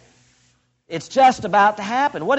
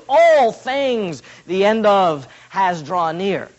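slow, word by word, stress on All; All things set apart and lingered on; literal rendering; 4 dB louder, much slower than usual. Shown as a demonstration. Preacher giving the literal Greek order, slow and deliberate. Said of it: "Literally, it is".

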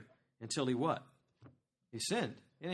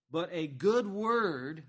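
slow and louder, leaning on good; slow emphatic reading; 3 semitones higher, 6 dB louder, much slower than usual. Acted. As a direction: stretch out the promise of a good word. Reading next half of verse.

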